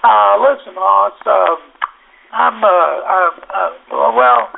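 imitating a nervous, halting phone question; stuttering and stammering. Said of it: "he stutters and stammers and goes".

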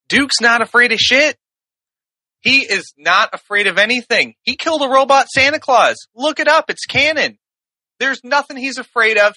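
high, emphatic insistence; impassioned defence. Real, not acted. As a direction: argue it like an outraged superfan. Heated defence of his champion.

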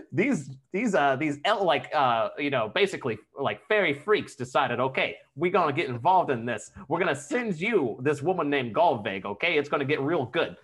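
put-on New York-Jersey wise-guy voice; New Jersey-New York accent. Podcaster voicing fairy freaks joining the war, mock New York-Jersey accent. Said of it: "New Jersey and New York".

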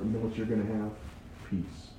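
soft, low, intimate reveal; quietly drawing them in; hushed. Real, not acted. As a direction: drop the voice for the word peace. Preacher hushes for the point.

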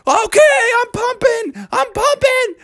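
high, frantic yelping; acted desperate reply under pressure; panicked father. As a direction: squeal back in a flustered panic. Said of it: "Father was like".

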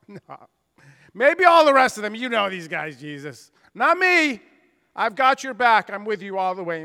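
loud, boastful protest as Peter; voicing Peter. Preacher voices Peter boasting loyalty to Jesus, loud and cocky. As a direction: brag that you'll never leave him. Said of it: "And Peter says".

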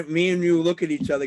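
usual speaking voice

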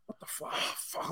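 breathless, unvoiced disbelief; whispered what the fuck. Viewer left on a cliffhanger, whispering in disbelief. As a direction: mouth it under your breath, stunned. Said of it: "sitting there going".

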